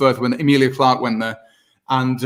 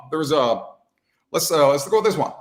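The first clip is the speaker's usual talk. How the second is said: usual speaking voice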